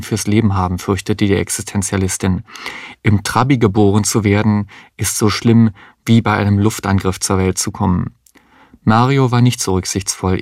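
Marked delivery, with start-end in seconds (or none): none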